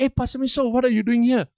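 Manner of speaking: retold surprise, voice lifted high; surprised greeting. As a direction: imitate a surprised, high-pitched hello. Young man surprised to meet pastor.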